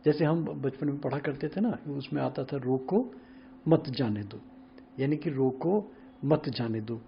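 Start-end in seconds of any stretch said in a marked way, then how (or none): 2.6-4.4 रोको, then a break, मत जाने दो together; pause after रोको. Shown as a demonstration. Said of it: "comma अगर थोड़ा सा इधर लगा दें". 5.3-7.0 slow, with a clear break after रोको; रोको alone, then मत जाने दो as one phrase; pause after रोको. Shown as a demonstration. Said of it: "comma अगर थोड़ा सा इधर लगा दें".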